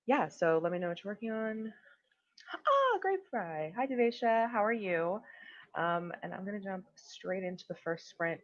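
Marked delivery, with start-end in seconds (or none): 2.7-5.2 higher, louder, delighted hello; excited greeting. Real, not acted. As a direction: open on a long high "Ah", then greet them brightly. Spotting familiar viewers arriving in chat.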